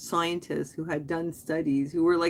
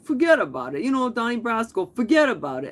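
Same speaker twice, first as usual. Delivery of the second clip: lightly done New York wiseguy; Brooklyn mobster accent; 5 semitones higher, 5 dB louder than usual. Retold or imitated. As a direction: say forget about it like a mobster from Donnie Brasco. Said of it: "I said it like that, like".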